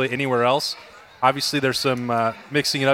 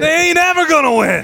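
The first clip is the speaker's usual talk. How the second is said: mock yelling; 14 semitones higher, 6 dB louder than usual. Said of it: "is just yelling".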